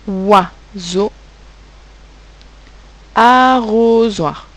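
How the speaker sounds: OISEAU and ARROSOIR slowly, each syllable held apart; dictated by syllables. Shown as a demonstration. Teacher dictating words to pupils, dictated by syllables. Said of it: "on dit les mots".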